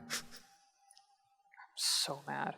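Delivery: very slow, unvoiced angry whisper. Real, not acted. Gamer whispering her anger while muted, slow whisper. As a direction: seethe it in a whisper.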